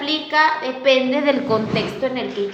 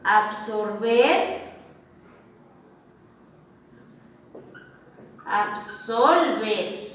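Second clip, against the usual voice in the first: "Absorber" then "Absolver", very slow with each syllable clear; slow careful contrast; 4 semitones lower, 4 dB quieter, much slower than usual. Shown as a demonstration. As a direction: stretch both words so the r and the l stand apart.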